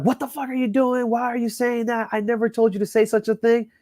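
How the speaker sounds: high-pitched outraged bride, retold; retold freak-out. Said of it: "freaking the fuck out at me".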